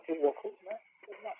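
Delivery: imitating brother, high and soft; calming down. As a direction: ease into quiet, relieved agreement. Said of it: "Now he calms down".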